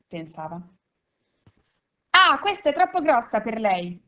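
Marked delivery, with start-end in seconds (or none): 2.1-4.0 loud, higher burst of outrage; shouting. A narrator's reading. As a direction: yell it at the lieutenant. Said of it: "gridò".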